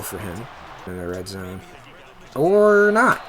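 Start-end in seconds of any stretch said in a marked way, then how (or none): 2.3-3.2 high, loud, drawn-out reversal; surprised exclamation. Real, not acted. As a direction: cry out as the play flips on you. Play suddenly goes against expectation.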